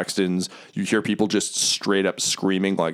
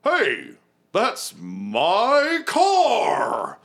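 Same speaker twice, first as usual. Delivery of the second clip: high stretched indignant yell; outraged cry; 14 semitones higher, much slower than usual. Acted. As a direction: shout it in shocked outrage. NPC shouts indignant.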